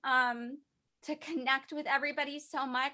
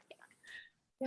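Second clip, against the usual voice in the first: whispered; 10 dB quieter than usual. Real, not acted. Soft close after heartfelt thanks.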